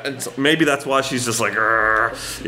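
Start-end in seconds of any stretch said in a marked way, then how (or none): 1.5-2.4 low drawn-out "grr" of frustration; mock angry growl; imitated growl. Imitating the fuming flight attendant, a low growl.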